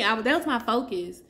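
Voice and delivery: ordinary talk, nothing marked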